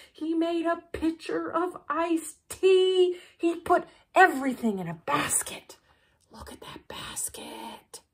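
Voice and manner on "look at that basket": hushed aside to the listeners; whisper. Real, not acted. Storyteller whispering an aside to the children. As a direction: whisper it, pointing out the basket.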